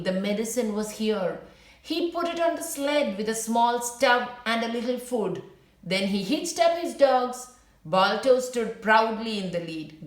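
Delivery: clear, gentle storybook reading; reading to children. A narrator's reading. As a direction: read the story aloud to young listeners. Teacher reads story to class.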